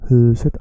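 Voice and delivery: huset, hu long and loud; stretched first syllable. Shown as a demonstration. Teacher isolating the stretched huset. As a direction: draw out hu in huset. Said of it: "I'm stretching the first syllable".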